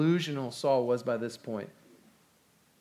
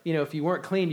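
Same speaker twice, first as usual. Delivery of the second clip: ordinary talk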